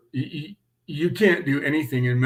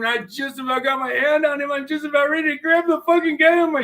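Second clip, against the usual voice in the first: excited, building to the peak of the chase; breathless excitement; 11 semitones higher, 4 dB louder, faster than usual. Real, not acted. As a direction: pitch climbing higher and louder toward the grab. Chase climax, rising excitement.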